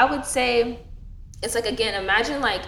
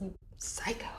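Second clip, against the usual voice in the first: unvoiced whisper under the breath; 18 dB quieter than usual. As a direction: hiss it so grandma can't hear. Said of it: "And I was like".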